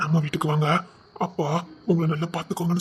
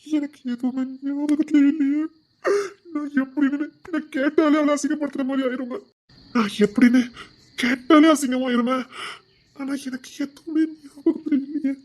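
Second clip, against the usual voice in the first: higher, slow, crying through the words; tearful anguish; 6 semitones higher than usual. Acted. To his sister, unable to remember: crying anguish. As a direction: break down as you plead. Distraught husband near tears.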